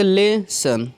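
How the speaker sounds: लेसन very slowly, split as "le - san"; syllable by syllable. Shown as a demonstration. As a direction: break लेसन into two separate syllables. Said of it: "ले एक शब्द खंड हो गया". (लेसन को दो खंडों में, धीरे "ले - सन" बोलें)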